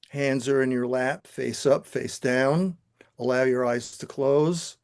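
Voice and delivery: calm, steady guiding voice, unhurried; guided meditation. Acted. Teacher guiding the class through the visualization, calm meditation voice. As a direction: lead the room gently into stillness.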